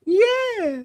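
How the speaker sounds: very high, eager yes; very high mock squeal of agreement; squeaky eager yeah. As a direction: squeal a yes like a pleased wife. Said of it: "Every time she was like".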